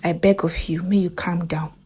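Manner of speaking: hushed pleading whisper; doctor whispering to David before bad news. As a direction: whisper it gently to calm him.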